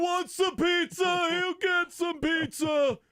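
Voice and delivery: high, drawn-out comic holler; hammed-up squealing shout; shouted character voice. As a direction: belt it out in a goofy high yell. Acts excited character yelling about pizza.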